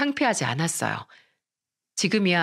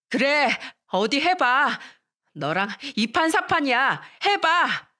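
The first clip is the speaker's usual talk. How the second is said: higher-pitched, reckless challenge; angry defiance; 6 semitones higher, slower than usual. Acted. Girl gripping her rival's hair dares her on, defiant. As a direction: dare her to go ahead. Narrator defies classmate in fight.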